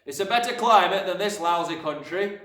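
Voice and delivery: brash, impatient father; irritable father. A narrator's reading. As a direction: grumble it at a shout. Father snaps at questioning daughter.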